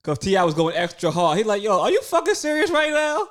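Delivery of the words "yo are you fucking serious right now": high, loud retold disbelief; mock outraged question; mock incredulous. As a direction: act out a loud, incredulous challenge, pitched way up. Acting out an incredulous retort.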